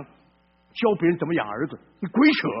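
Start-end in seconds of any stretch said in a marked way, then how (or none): 2.0-2.5 loud, higher rebuke; scolding. Acted. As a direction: call it nonsense with force. Preacher scolds the seminary dean.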